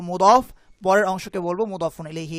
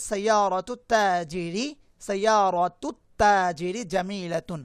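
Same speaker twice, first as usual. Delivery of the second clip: slow model reading; slower than usual. Shown as a demonstration. Teacher models Arabic sentence slowly.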